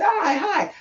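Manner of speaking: high, sugary mock hello; overly polite high hi; sweet high greeting. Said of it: "So I said".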